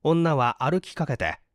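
ordinary delivery, nothing marked

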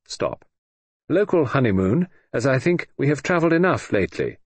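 brisk telegram dictation; telegram reading. Acted. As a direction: read it like a wire, stopping flat on every stop. Telegram read aloud.

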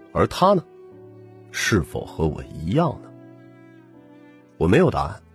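ordinary delivery, nothing marked

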